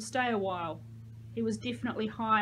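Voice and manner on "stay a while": ordinary delivery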